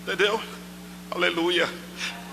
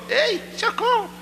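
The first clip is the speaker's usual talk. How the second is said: mock cry for help, much higher; imitating Márcio's cry; 14 semitones higher than usual. Preacher copying a congregant's cry for help, high mock yell. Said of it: "como foi que Márcio gritou?".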